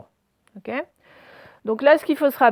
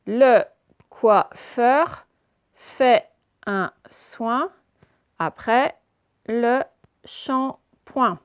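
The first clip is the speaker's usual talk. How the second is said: "Le coiffeur fait un soin après le shampoing" in slow, separated pieces; very slow, emphatic decoding of "Le coiffeur fait un soin après le shampoing"; syllable-by-syllable reading; 5 dB louder, much slower than usual. Shown as a demonstration. Teacher models syllable-by-syllable reading.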